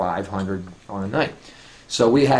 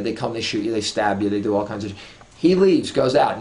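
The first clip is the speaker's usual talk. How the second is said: ordinary talk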